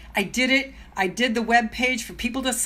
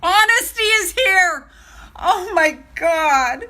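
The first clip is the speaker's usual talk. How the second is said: high, loud, slow squeal of delight; real thrilled greeting; 8 semitones higher, 6 dB louder, slower than usual. Real, not acted. Greeting a viewer who just joined, real excited squeal. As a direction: cry out, overjoyed to see them. Spots another viewer joining.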